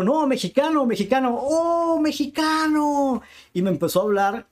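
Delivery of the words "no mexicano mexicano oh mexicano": much higher, louder, insisting he is Mexican; eager protest. Retold or imitated. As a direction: plead it high and urgent, stretching the last mexicano slowly. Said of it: "Y yo". (protesta aguda e insistente: "¡no, mexicano!")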